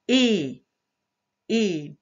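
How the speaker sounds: ET as a held pure É, repeated; isolated sound. Shown as a demonstration. Teacher making a vowel heard, drawn-out isolated sound. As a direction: hold the vowel of ET, pause, repeat it. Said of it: "On entend".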